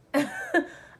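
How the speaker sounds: sudden high loud laugh; real burst of laughter. Real, not acted. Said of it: "I laugh again".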